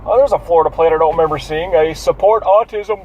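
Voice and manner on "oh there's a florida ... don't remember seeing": ordinary delivery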